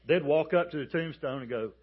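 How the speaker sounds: ordinary talk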